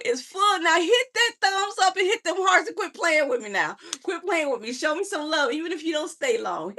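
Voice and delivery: high, excited pleading with the audience; high-pitched mock nagging, all in fun; playful mock scolding.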